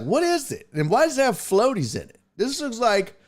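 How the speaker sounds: high, fast grossed-out rant; mock revulsion at a murky drink; disgusted complaint. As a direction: sound repulsed by the floaties. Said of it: "I'm like".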